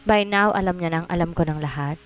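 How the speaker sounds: ordinary delivery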